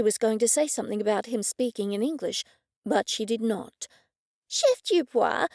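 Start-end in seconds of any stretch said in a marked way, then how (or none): 4.5-5.5 slow, higher lightly voiced woman; Cressida's voice. Cressida addressing the chef, drawn out and higher. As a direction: call his name, drawn out and high.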